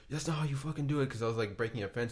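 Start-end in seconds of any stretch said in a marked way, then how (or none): 0.1-1.1 mock angry dad, pitched higher; a hint of the dad snapping at his son; lightly voiced irritated father. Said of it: "He was like".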